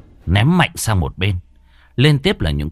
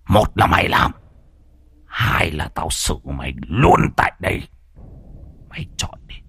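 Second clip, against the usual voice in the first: put-on menacing old man; 4 semitones lower than usual. Hỷ threatens Thắng.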